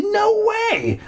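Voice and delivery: very high-pitched indignant outburst; angry protest. Acted. Narrator turning on the sister, angry high outburst. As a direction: refuse at the top of your pitch range. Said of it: "I turned angrily to my sister".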